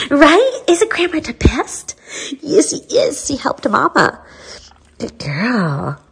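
high, sing-song pet voice. Real, not acted. Owner asking her dog whether Grandma is the best, high baby talk. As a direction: coo at the puppy.

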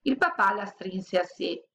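plain reading voice

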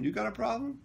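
high, rising question at normal volume; retold comeback. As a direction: throw the challenge back without shouting. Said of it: "I said".

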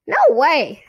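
high, loud puppet voice; squeaky dummy refusal. A narrator's reading. Dummy echoes the girl's refusal, high loud squeak. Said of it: "she made Slappy say".

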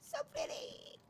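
breathless whispered "So pretty", all air and no voice. Real, not acted. Gamer alone whispering to the game scenery. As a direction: hush it out in awe. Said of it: "same words said twice".

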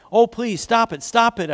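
mild storybook begging; crying plea. A narrator's reading. Clay begs amid paint fumes, mildly crying out. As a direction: plead with a touch of a cry. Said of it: "I cried".

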